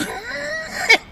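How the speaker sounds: sudden high squeal of a laugh; a real high laugh breaking out mid-story; high shrieking laugh. Real, not acted. Laughs after mock vow.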